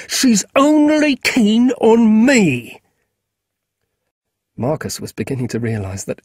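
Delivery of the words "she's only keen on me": loud, slow, higher shouted denial; sudden shout. Acted. Boy Ally yelling at Marcus, loud and slow. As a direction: bellow it at him, drawn out. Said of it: "Ally suddenly shouted".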